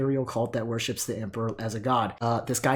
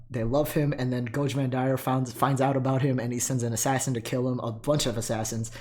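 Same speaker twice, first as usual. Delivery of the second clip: ordinary talk, nothing marked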